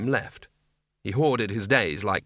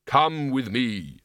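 slow, solemn Death voice; measured, unhurried, otherworldly Death; grim reaper voice; slower than usual. A narrator's reading. Death commands Albert.

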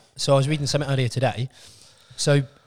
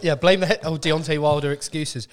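usual speaking voice

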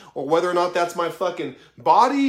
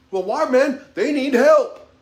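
higher, louder imagined objection; protesting on their behalf; 5 semitones higher, 5 dB louder, slower than usual. Acted. Past self objecting to people saying no, protesting. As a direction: plead their case, voice raised.

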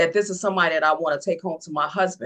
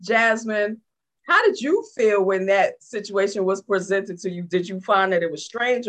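normal talking voice

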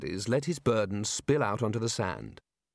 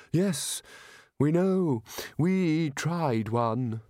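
higher, weak and very slow; weakly; 4 semitones higher, much slower than usual. A narrator's reading. Chair admits to the wizards they tried one, weakly. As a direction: let the words barely get out. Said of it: "said the Chair of Indefinite Studies weakly".

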